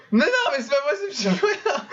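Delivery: high, laughing in disbelief; exasperated laugh. Real, not acted. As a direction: laugh helplessly at the chaos. Stream keeps bugging, exasperated.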